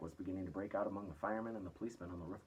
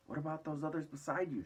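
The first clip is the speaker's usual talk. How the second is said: suspicious shout, higher; 8 semitones higher, 4 dB louder than usual. A narrator's reading. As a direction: shout the question up warily. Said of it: "shouted the chief of police".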